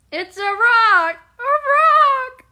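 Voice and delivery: high, loud cartoon insistence; SpongeBob voice. Streamer imitating SpongeBob for Logan, high and loud. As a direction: shout it like an indignant SpongeBob. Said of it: "we're mimicking SpongeBob".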